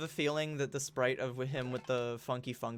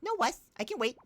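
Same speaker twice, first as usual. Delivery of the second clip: high cutesy uwu character; squeaky cute creature voice; 7 semitones higher than usual. Acted. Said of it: "back and forth uwu voices".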